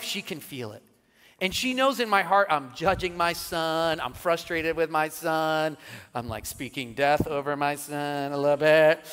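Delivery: ordinary talk, nothing marked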